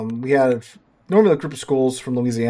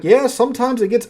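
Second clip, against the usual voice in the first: put-on a higher, louder first try at a Puerto Rican accent, dropped at once; abandoned Puerto Rican accent; 11 semitones higher, 4 dB louder than usual. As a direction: start the teacher's Puerto Rican accent, then give up on it. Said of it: "I can't do her Puerto Rican accent any, any justice".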